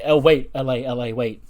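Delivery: a loud call, then settling back to normal; calling after him. Retold or imitated. Wesley calling LA Knight back as he leaves, loud call. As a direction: shout after someone walking away, then soften.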